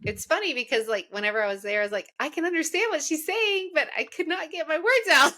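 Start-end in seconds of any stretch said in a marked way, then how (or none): 2.2-5.3 higher-pitched retold protest; exasperated self-quote. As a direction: retell your tongue-tied frustration, pitched up. Retells her own frustration speaking French.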